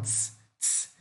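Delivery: low, soft hissed "tss"; voiceless ts hiss; quiet unvoiced tss; ts sound. Shown as a demonstration. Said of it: "esse tipo de som".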